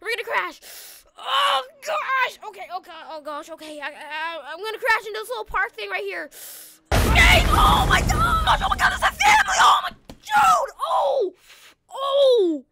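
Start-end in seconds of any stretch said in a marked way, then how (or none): 1.2-2.4 high, loud wail of alarm; role-played dread, pitched up; panicked cry. Bracing for the acted crash. 6.9-12.6 very high, very loud screaming; shrieked alarm trailing into pained cries; panicked scream. Pretend. Acted crash into a family.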